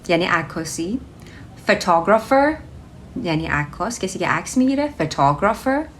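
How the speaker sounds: usual speaking voice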